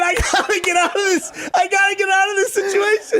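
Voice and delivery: high, cracking laugh-wail; laughing cry. Retold or imitated. Retelling the airport mix-up to cohosts, wailing through laughter. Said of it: "I said".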